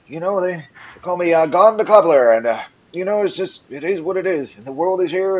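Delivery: put-on high-pitched, loud old-timer; prattling old man. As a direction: prattle on as a feisty old cobbler. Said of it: "keeps prattling on and on".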